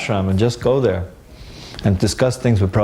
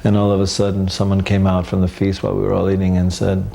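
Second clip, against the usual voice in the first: ordinary delivery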